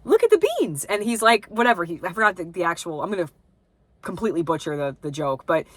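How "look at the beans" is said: very high, bright-eyed product-plug voice; mock sitcom wife advertising in the middle of a scene; imitating fake TV wife. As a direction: chirp it like a staged commercial wife. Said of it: "and she's like".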